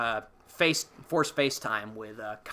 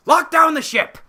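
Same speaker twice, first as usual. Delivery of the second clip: much higher and louder mock command; shouted order; 12 dB louder than usual. Podcaster voicing Kylo ordering the ship locked, a loud mock shout. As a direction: yell the order across the ship. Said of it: "and Kylo's like".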